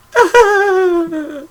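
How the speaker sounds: high shrieking pig laugh; squealing piggy laugh; Miss Piggy laugh. Shown as a demonstration. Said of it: "I can do the laugh all right".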